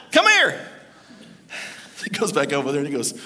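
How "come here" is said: high, pushy parrot summons; parrot beckoning. Acted. Parrot calling the customer over, insistent.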